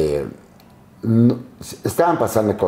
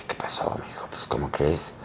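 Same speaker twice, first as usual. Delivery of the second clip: whispered, much softer; hushed reply; 6 semitones lower, 11 dB quieter than usual. Retold or imitated. Producer brushing off the narrator's complaint, whispered. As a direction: murmur it dismissively.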